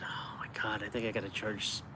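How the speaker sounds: breathy hushed whisper, as if confessing a secret. Retold or imitated. Vlogger retelling his own thought, whispered. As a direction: drop to a whisper for the private thought. Retelling a private realisation about prices.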